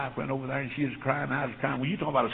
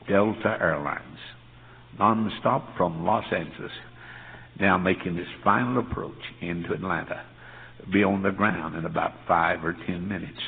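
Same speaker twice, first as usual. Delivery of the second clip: airport announcement; 6 semitones lower than usual. Retold or imitated. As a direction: intone it like the terminal PA. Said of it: "It said".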